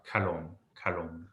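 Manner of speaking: kalom with a throaty C-H start; guttural k. Shown as a demonstration. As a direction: model kalom, first sound made in the throat. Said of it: "but it's something like".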